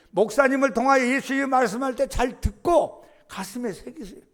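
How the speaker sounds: loud, high, urgent exhortation; full-force preaching. Real, not acted. Pastor urging the congregation to listen, loud and high. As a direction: drive it home to the congregation. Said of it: "preaching at full force".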